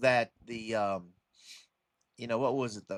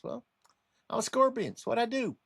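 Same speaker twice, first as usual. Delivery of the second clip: high-pitched character voice; matter-of-fact scorpion; 11 semitones higher, faster than usual. Acted. Scorpion explaining itself to the drowning frog, high and matter-of-fact. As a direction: answer as the scorpion, voice pitched right up. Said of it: "He goes".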